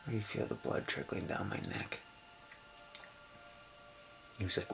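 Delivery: ordinary talk, nothing marked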